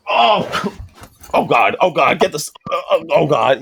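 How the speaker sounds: hammed-up moans of pleasure; mock groaning oh God; mock moaning. Cigar lounge guy hammed-up moaning.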